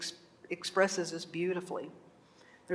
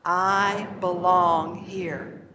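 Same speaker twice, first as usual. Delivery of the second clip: very slow, louder affirmation; leading a mantra; 5 dB louder, much slower than usual. Shown as a demonstration. Teacher asking the class to say it along, very slow mantra. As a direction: lead the room, drawing out every word. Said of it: "Would you say that with me?".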